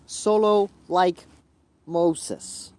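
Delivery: slow and loud, word by word; sounding it out. Real, not acted. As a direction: spell out the phrase slowly for a listener. Trying out a Spanish phrase.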